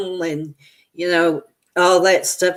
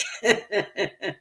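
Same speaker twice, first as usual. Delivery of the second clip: quiet trailing high giggle; soft giggle; 3 semitones higher, 7 dB quieter than usual. Real, not acted. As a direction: let the giggle fade out. Laughing at dog swallowing thong.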